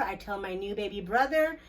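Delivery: usual speaking voice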